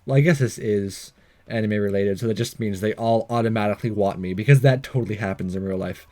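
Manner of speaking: ordinary talk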